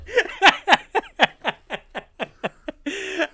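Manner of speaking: long, high laughing fit. Real, not acted. Host cracking up at own disclaimer, long laugh. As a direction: keep laughing helplessly, high and drawn out.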